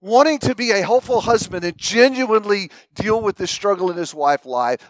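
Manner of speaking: ordinary talk, nothing marked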